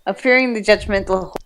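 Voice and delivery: ordinary talk, nothing marked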